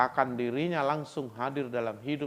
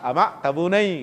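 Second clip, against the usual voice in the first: loud Batak cry of delight, higher; Batak exclamation; 5 semitones higher, 9 dB louder than usual. Retold or imitated. Batak man given durian, loud Batak delight. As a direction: call out happily in Batak. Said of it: "dikasih sama orang Batak".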